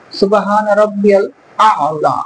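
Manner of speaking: slow, with রব্বিয়াল doubled ব্ব and a long আলা; careful recitation. Shown as a demonstration. Teacher modelling the correct recitation of সুবহান রব্বিয়াল আলা. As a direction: recite সুবহান রব্বিয়াল আলা slowly, every sound held clear. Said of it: "এরকম।".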